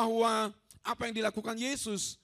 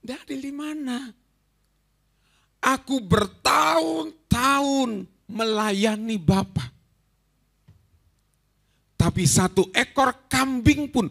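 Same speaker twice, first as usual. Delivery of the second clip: resentful son; 4 semitones higher, 4 dB louder, slower than usual. Retold or imitated. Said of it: "anak sulung ini marah".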